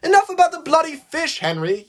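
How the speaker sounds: high, loud yell; loud, fed-up outburst; exasperated yell. A narrator's reading. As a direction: yell it high to shut him up. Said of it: "yelled Thomas".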